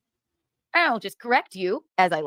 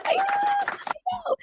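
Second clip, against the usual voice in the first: mock high voice; 3 dB quieter, much slower than usual.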